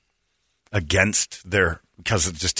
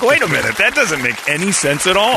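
incredulous; 9 semitones higher, 4 dB louder than usual. Retold or imitated. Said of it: "I'm like".

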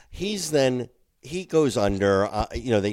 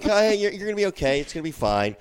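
reassuring dad; 8 semitones higher, 4 dB louder, faster than usual. Retold or imitated.

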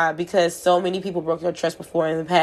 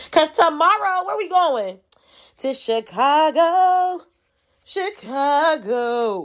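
excited; 9 semitones higher, much slower than usual. Real, not acted. Hyped about the Chicago trip.

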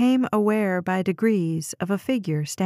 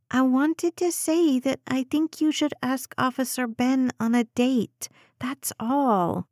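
put-on high, small child's voice; little girl voice; 5 semitones higher than usual. A narrator's reading. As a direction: voice a young girl, higher. Child teases mother.

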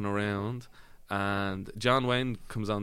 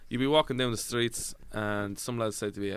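ordinary talk

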